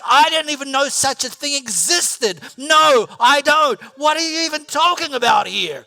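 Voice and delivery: high, loud baffled protest; bewildered outburst; incredulous protest. Retold or imitated. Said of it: "And I'm like".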